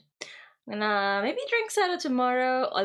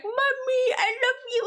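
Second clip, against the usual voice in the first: much higher, a sweet pet voice with a laugh; cat's adoring voice. Retold or imitated. Streamer voicing her cat greeting her return, high adoring coo. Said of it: "he's like".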